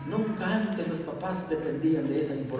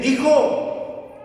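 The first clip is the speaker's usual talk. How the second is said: angry father demand; 11 semitones higher, 9 dB louder, slower than usual. Acted.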